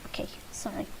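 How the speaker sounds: unvoiced whisper of an apology; quick whispered sorry; whispered aside. Real, not acted. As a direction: breathe it, no voice at all. Aside apology mid-lesson.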